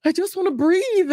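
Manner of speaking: exasperated mom. Retold or imitated. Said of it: "And you're like".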